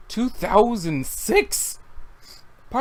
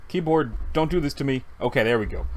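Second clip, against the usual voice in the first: normal talking voice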